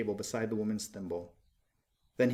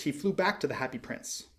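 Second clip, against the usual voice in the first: usual speaking voice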